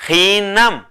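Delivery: hinam, drawn out with a falling end; teacher models hinam slowly for repeating; slow Kaqchikel word. Shown as a demonstration. Teacher models the word for the class, slow and clear. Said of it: "de hombre se dice".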